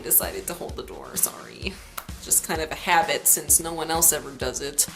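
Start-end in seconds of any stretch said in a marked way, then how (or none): 0.0-1.7 whispered aside. Real, not acted.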